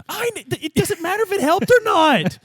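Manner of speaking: high, loud, strained cry of despair; anguished outburst. Acted. Detective cries out to his skill voices in despair. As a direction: voice cracking up in anguish. Player's character breaks down in despair.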